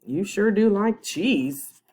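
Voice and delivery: ordinary delivery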